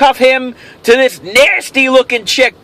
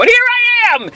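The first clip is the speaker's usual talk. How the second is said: showman flourish; 12 semitones higher than usual. Real, not acted. Grand reveal of his show.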